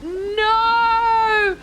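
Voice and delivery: long high loud wailed No; mock despair. Streamer reacting to chat's lost settings, long mock wail. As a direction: stretch it into a dramatic wail. Settings wiped, chat mourns.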